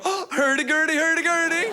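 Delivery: high singsong fake Norwegian; high mock-Scandinavian chant; mocking Swedes. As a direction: taunt with high hurdy-gurdy babble. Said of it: "looking at Norwegian people, go".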